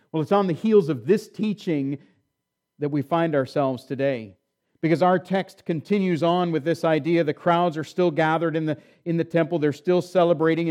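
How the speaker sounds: usual speaking voice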